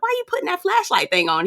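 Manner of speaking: imitating a puzzled woman. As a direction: squeak it out, curious and fast. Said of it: "And she was like".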